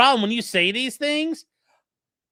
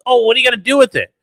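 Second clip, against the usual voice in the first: loud, fast mock question; mocking retelling; 2 semitones higher, 8 dB louder, much faster than usual. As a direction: imitate the lawyer's challenge, loud and quick. Acts out courtroom exchange, mockingly.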